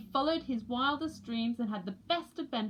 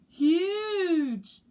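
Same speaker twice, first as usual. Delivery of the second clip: loud and higher; awed chick; 7 semitones higher, 8 dB louder than usual. A narrator's reading. Chick Poots marvels at something huge, loud. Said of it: "said Poots".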